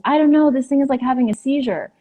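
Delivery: imitating someone startled and flustered; retold alarm. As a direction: voice jumps up in bewildered alarm. Retold alarm in a shaking elevator.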